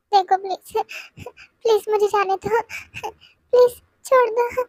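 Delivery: high, slow tearful begging; crying plea. Acted. [name] begs the attacker to let go, crying. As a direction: plead through tears to be let go.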